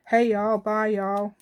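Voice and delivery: loud mock Southern American greeting; a playful Virginia "Hey, y'all" called out loud; Virginia accent. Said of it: "I know what they say down there".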